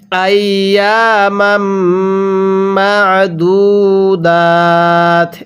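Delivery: slow, drawn-out tajweed recitation of আইয়ামাম মা'দুদাত; Quran recitation. Shown as a demonstration. Teacher shows students how to recite, slow melodic chant. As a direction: chant আইয়ামাম মা'দুদাত with long stretched vowels. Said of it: "কিভাবে পড়তে হবে?".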